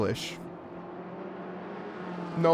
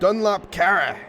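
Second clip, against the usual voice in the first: higher, emphatic German-style delivery; angry German pronunciation; 4 semitones higher, 3 dB louder, slower than usual. Shown as a demonstration. Commentator rehearsing the Dunlop corner's name, mock-angry German accent. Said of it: "when I said it angry, it was good".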